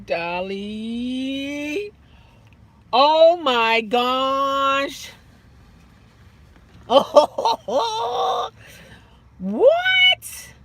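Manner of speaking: reacting to a viewer's surprise; amazed. Real, not acted. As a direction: stretch it out in disbelief. Drawn-out amazement, laughing.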